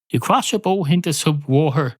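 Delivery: ordinary delivery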